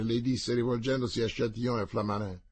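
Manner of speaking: ordinary delivery, nothing marked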